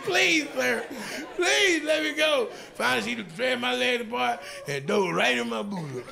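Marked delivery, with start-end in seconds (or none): 0.0-2.5 high slow plea. Retold or imitated. Begging again.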